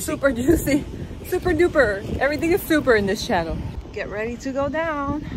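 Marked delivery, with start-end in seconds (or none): 0.0-3.6 high, loud and fast; excited. Real, not acted. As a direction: hype up the catchphrase. Playful super chant.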